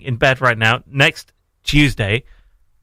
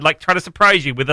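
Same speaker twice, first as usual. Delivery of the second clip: ordinary delivery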